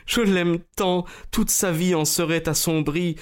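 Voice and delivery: high, imploring lady; tearful-lady entreaty, acted; pleading. A narrator's reading. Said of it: "supplia-t-elle".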